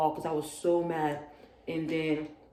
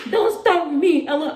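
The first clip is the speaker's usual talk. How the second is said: much higher, louder mocking the roommate; mock girl snapping; 10 semitones higher, 7 dB louder than usual. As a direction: snap back like the annoyed roommate. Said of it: "she's talking about".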